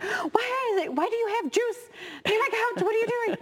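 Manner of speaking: high-pitched, exasperated parent outburst; exasperated reaction. Retold or imitated. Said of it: "you have that initial reaction".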